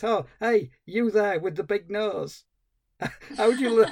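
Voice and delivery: hammed-up TV host yelling at a passer-by; mock shout. TV interviewer calling a bystander over, mock shout. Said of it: "he shouts off camera".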